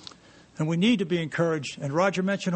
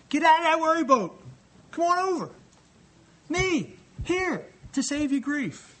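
God calling out; 10 semitones higher than usual. Pretend. Preacher voicing God calling the worried out of the boat, eager. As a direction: call them out of the boat like a coaxing father. God's call voiced as invitation.

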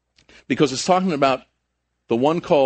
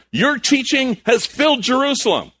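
high, emphatic accusation; acted criticism; 10 semitones higher, 4 dB louder than usual. Critics accusing Peter and John, high-pitched indictment. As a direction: throw it out as a ringing public charge. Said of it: "They criticized them".

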